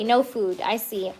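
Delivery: soft, settled no food; quiet acceptance. Real, not acted. Accepting the card's message.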